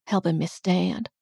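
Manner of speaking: usual speaking voice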